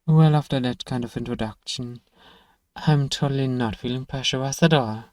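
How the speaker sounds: usual speaking voice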